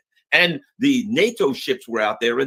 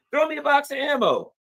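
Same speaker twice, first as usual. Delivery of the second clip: mock call; 12 semitones higher than usual. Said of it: "soldier calling for ammo".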